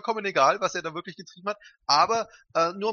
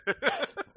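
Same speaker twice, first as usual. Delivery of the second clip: high laugh; 6 dB quieter than usual. Real, not acted. Laugh at joke.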